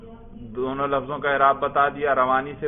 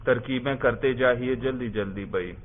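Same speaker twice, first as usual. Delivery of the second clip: ordinary talk, nothing marked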